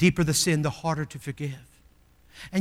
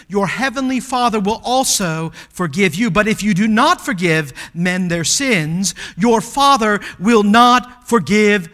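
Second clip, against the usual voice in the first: loud, higher recited prayer; preached prayer; 4 semitones higher, 10 dB louder than usual. Acted. Preacher leading the congregation in the Lord's Prayer, loud proclaiming. Quotes Jesus' verses forcefully.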